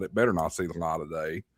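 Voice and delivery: ordinary talk, nothing marked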